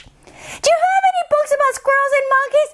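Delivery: put-on high shouting little girl. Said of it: "loud Decibella in library".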